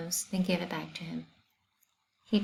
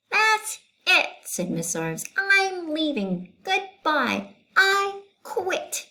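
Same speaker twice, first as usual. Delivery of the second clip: high, loud, exasperated teacher voice; storming out, done with it all; teacher quitting on the principal, high and fed up; 12 semitones higher, 4 dB louder, slower than usual. Acted. Miss Zarves quits.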